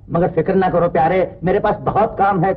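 ordinary talk, nothing marked

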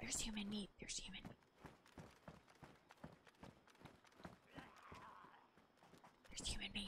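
hushed unvoiced whisper. Real, not acted. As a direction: whisper it so nobody hears. Sneaking, found meat.

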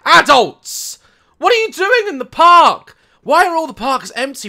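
high, loud mocking yell; shouting at the screen. Real, not acted. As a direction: shout him down in disbelief. Shouting at the singer.

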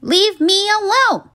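high, exasperated plea; annoyed. Acted. Girl pestered by her unicorn, exasperated. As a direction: push him off in irritation.

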